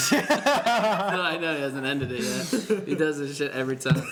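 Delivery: loud, high laughter mid-sentence; laughing. Real, not acted. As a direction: talk through laughter. Laughing at the friend.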